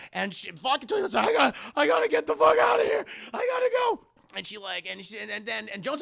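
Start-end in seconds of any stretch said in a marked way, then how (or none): 1.3-4.1 high, fast, shouted panic; hammed-up terrified shriek; panicked escape. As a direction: yell it while desperate to bolt. Friend panicking to flee séance.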